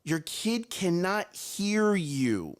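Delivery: slow it right down to land it; slow emphatic. Shown as a demonstration. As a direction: lecturer repeating it slowly to parents. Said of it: "slowed repeat".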